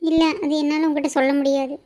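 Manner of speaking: ordinary delivery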